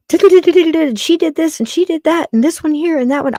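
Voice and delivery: high, quick mocking gossip voice. Imitating a gossiping caller's chatter. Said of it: "it's not gonna be filled with".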